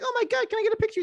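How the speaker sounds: high-pitched fan squeal; excited mock fangirl voice; starstruck fan. Acting a thrilled fan spotting bear.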